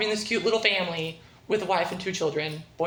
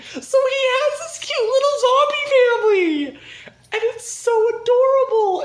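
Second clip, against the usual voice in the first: very high, loud squeal of delight; gushing squeal; 14 semitones higher, 8 dB louder than usual. Real, not acted. Fan gushing over the cute zombie family, squealing. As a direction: squeal it out, overcome by cuteness.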